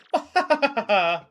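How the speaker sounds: high, loud cartoon cackle; hammed-up Mario laugh. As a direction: laugh big and high like a showy game hero.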